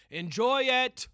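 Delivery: put-on high, loud yell at the kids; mock shout. Calling out to the children, a high mock shout. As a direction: holler it across the playground.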